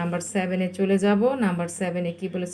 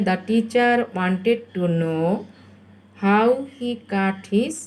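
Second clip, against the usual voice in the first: very slow dictation; much slower than usual. Shown as a demonstration. Teacher gives pupils time to write, very slow dictation. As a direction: stretch each word out. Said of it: "আমি খুব আস্তে আস্তে বলছি".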